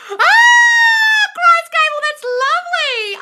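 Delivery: high, loud thrilled cry; excited shout. Real, not acted. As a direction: squeal with delight, then slow down. Thrilled reading a viewer's success.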